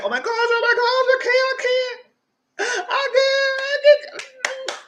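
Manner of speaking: high, slow panicked whimpering; mock crying in a high frantic voice; frantic high wail. Frantic woman pleading after the account freezes, high whimpering wail. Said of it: "So she was frantic".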